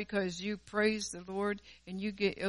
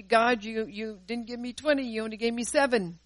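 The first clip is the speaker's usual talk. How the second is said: ordinary talk, nothing marked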